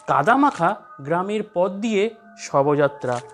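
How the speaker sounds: plain reading voice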